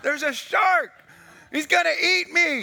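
mock terror about a shark; screaming shark in a panic; panicked cry. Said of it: "I'm going".

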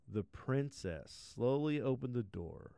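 ordinary delivery

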